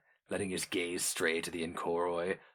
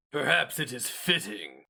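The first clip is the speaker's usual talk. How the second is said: hero mage voice; 7 semitones higher than usual. A narrator's reading. Taturga addresses the Inkoroi.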